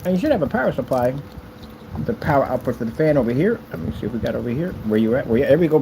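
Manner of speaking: normal talking voice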